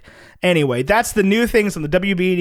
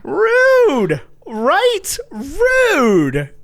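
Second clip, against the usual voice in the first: mock outrage; 10 semitones higher, much slower than usual. Mock outrage at harsh review.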